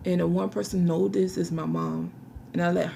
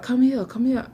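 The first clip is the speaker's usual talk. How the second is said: imitating the friend's summons; 6 semitones higher, 5 dB louder than usual. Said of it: "he talked about".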